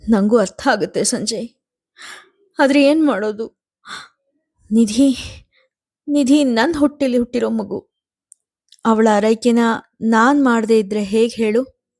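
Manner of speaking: a hint of a tearful mother defending her child; mother torn and helpless, lightly voiced; wife Swathi, pleading. A narrator's reading.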